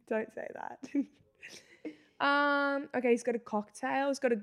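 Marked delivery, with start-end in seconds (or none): 0.1-0.8 whisper. Real, not acted. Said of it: "unvoiced aside".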